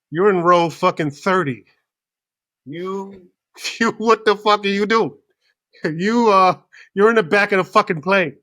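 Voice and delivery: hammed-up disbelief at a clueless passenger; exasperated outburst. Acted outburst at line-holding passenger.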